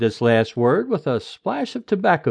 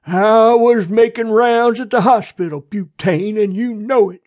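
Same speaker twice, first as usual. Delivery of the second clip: irritated coroner, raised and higher; growled retort; 8 semitones higher, 7 dB louder than usual. A narrator's reading. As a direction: snap back at the sheriff, loud and higher. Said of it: "before he growled".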